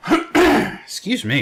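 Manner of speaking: coughing into the mic; coughing fit. Real, not acted. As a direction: cough through the words. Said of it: "I keep coughing in the mic".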